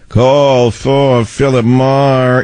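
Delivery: high-pitched, drawn-out bellhop-style call; Johnny Philip Morris. Acted. Host recalling his boyhood kid voice, high-pitched. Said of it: "sound like Johnny Philip Morris".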